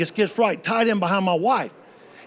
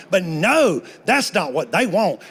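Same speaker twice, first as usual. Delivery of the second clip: usual speaking voice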